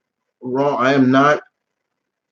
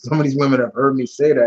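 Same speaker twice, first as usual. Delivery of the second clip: ordinary delivery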